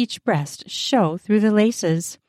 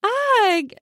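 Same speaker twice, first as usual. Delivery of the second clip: plain reading voice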